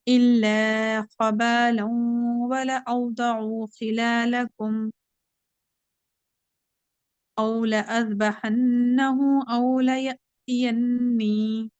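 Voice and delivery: slow, drawn-out tajweed recitation; Quran recitation. Shown as a demonstration. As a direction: chant the verse slowly with care. Teacher recites Quran verse. (धीमी तजवीद वाली तिलावत)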